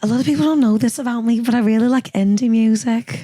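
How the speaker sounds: Geordie impression slipping into Scouse; wobbly Scouse-sounding Geordie accent. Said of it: "my favorite thing that [name]'s ever said".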